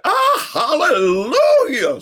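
high, loud, slow drawn-out cry; slow joyful hallelujah. Retold or imitated. Neighbor hears brother died, loud slow hallelujah. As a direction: stretch the hallelujah out. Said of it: "said Mister Parker".

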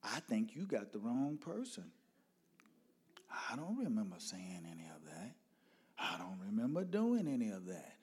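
soft-spoken Southern father; polite Southern lilt. Retold or imitated. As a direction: answer politely with a lilting Southern accent. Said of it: "southern voice and the lilt".

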